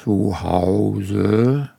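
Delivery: very slow, drawn-out Santa question; put-on Santa voice. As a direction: stretch it out like a department-store Santa. Santa character questions a child. (langgezogene Weihnachtsmannstimme)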